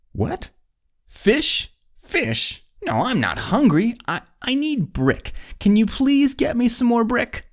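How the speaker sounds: high-pitched, mock exasperation; baffled builder. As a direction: puzzle aloud, then plead in a very high voice. Builder baffled, pleading for brick.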